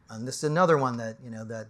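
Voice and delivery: ordinary talk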